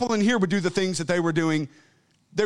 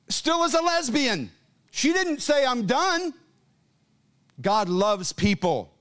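full-force preaching; 8 semitones higher than usual. Acted. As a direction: drive it home from the pulpit.

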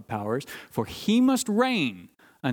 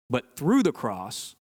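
slow, leaning hard on "through"; stress on through; 3 semitones lower, slower than usual. Shown as a demonstration. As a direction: draw out "through" to set it against "to". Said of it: "let me say it like this".

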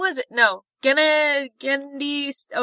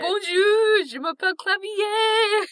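French ghost voice; 8 semitones higher, slower than usual. Pretend. Mock French ghost greeting.

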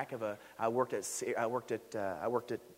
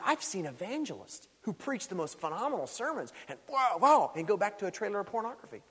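louder, higher, driving preacher's build; full-force preaching; 9 semitones higher, 5 dB louder than usual. Acted. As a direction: preach it hard to the congregation.

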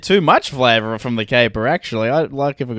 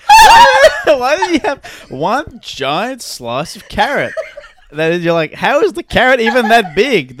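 high, incredulous comic rant; hammed-up baffled diner; mock outrage; 8 semitones higher, 6 dB louder than usual. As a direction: demand an answer through laughter. Outraged customer act about carrot.

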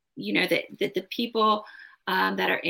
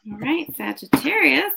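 higher, louder, bright sign call; upbeat announcement; 7 semitones higher, 5 dB louder than usual. Real, not acted. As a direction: announce it like a host. Announcing the sign's reading.

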